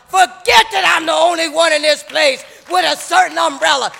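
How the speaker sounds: loud, high preacher's cadence; shouting sermon to the congregation; full-force preaching. Acted. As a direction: preach it hard, driving each phrase.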